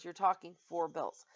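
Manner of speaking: normal talking voice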